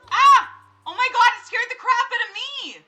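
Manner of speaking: high, loud startled yelp then gasping words; jumping out of the seat in fright; real startled scream. Real, not acted. Gamer scared by the ghost, screaming. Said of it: "I literally almost screamed".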